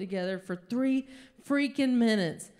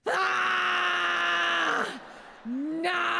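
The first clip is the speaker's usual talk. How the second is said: anguished scream; 6 semitones higher than usual. Retold or imitated. The comedian seeing her dog bleeding, screaming in anguish. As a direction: scream it out in horror. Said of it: "I just start going".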